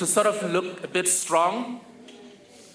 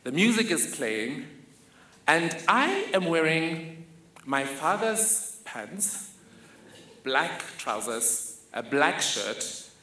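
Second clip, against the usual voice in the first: normal talking voice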